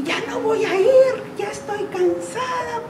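mock sobbing complaint, half laughing; imitating crying daughter. Mother voicing her daughter crying after practice, high and weepy. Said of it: "llega Alejandra llorando".

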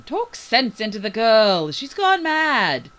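high, loud, drawn-out plea; alarmed appeal. A narrator's reading. Doctor appeals to the captain about Bailey, loud and alarmed. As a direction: appeal loudly, stretching the words. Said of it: "Doctor Scrandon appealed to the captain".

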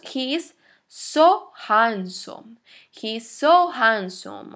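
slow sentence with SO held long; stress on SO. Shown as a demonstration. Teacher models saying someone is very handsome, long stress on SO. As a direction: stretch SO for intensity. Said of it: "cuando queremos dar intensidad".

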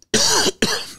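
sudden hacking cough. Real, not acted. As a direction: cough hard, choking a bit. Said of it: "sorry, I'm choking here".

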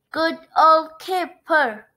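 hearty drawn-out praise; calling out. A narrator's reading. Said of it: "everyone called".

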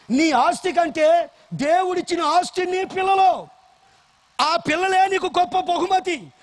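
high, loud rebuking sermon; full-force preaching. Acted. Pastor warning parents about their children, loud and high. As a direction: hammer the warning home to the parents.

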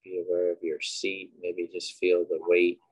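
unhurried, quiet cues for breath and body; guided meditation. Acted. Leading listeners through breathing and a body scan, guided meditation.

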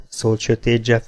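plain reading voice

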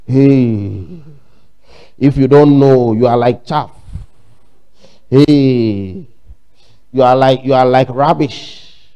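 low, loud mock-divine rebuke; imitated prophetic thundering, low and drawn out; mock Holy Spirit voice. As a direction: boom it out low like a fake prophet. Mimicking a supposed Holy Spirit rebuke.

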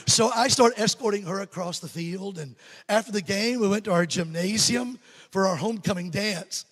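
usual speaking voice

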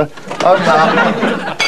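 ordinary talk, nothing marked

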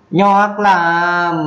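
"Nhọc lắm" lên cao, nhọc vừa nặng vừa sắc; nhấn "nhọc" cao và gắt; Mường nhọc. Shown as a demonstration. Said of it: "vừa có dấu nặng, vừa có dấu sắc". ("nhọc" dấu nặng lẫn dấu sắc)

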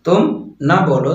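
usual speaking voice

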